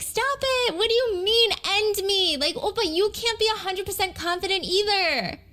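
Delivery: cute, high-pitched mock outrage; joking angry. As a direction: imitate a girl playfully scolding in aegyo. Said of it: "joking angry".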